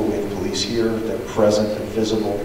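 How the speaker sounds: ordinary talk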